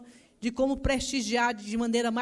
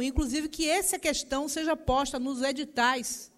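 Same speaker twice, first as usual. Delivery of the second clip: usual speaking voice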